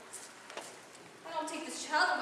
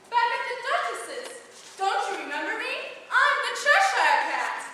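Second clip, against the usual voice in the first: higher, louder, proud reveal; grand announcement; 7 semitones higher, 7 dB louder than usual. Acted. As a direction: present yourself with flourish. Cat announces itself grandly.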